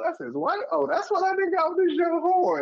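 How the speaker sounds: high, sudden realization; mock realization. Onlooker spotting the sailor's wife, high mock realization. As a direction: it all clicks, pitch shooting up. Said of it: "you be like".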